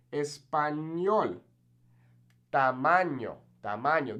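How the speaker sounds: "Español", "Tamaño" with a clear ny; N Y sound. Shown as a demonstration. Teacher models ñ words, N Y sound. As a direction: say "Tamaño" as ta-MAN-yo. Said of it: "makes this kind of N Y sound".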